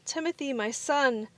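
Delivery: high, excited calling out; a hint of a father calling his son; joyful call. A narrator's reading. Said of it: "he shouted".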